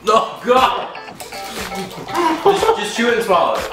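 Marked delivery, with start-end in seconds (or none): none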